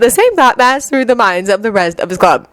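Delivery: ordinary delivery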